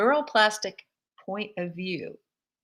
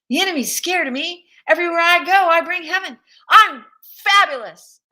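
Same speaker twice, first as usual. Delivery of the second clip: higher and louder, building to a laughing cry; triumphant declaration; 10 semitones higher, 10 dB louder than usual. Shown as a demonstration. Preacher showing the church a power statement, ecstatic and loud. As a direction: declare it like you own the day, rising to a joyful cry. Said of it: "I challenge you to come up with a power statement".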